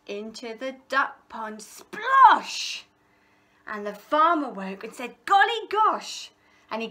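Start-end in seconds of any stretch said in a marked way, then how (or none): 1.6-2.8 high, loud splosh; splash sound. A narrator's reading. Thieves fall into duck pond. 5.2-6.3 high, loud surprised exclamation; startled farmer. A narrator's reading.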